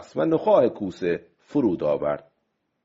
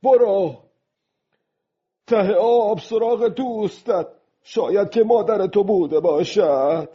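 high, raised-voice taunt; loud voice; 10 semitones higher, 4 dB louder than usual. A narrator's reading. Old man sending the shark to the sea bottom, loudly. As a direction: call out loudly, pitched well up. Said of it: "پیرمرد با صدای بلند گفت".

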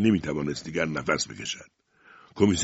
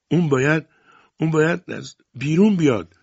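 high, louder insistent plea; urgent insistence; 9 semitones higher, 6 dB louder than usual. A narrator's reading. Tishin insisting to the police that the child come out, urgent and high. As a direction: press the demand, pitched well up.

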